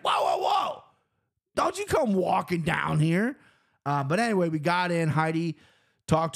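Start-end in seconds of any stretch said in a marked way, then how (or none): 0.0-3.4 high-pitched, exaggerated guard's halt; hammed-up high warning, slowing at the end; mock snapping guard. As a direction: imitate an overbearing guard stopping someone. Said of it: "why you're snapping at me".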